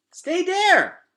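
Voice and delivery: put-on very high, loud little-girl command; a small child ordering the bugs to stay; bossy toddler order. Boots ordering the roaches to stay, high loud toddler command. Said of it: "said Boots, pointing at Temp".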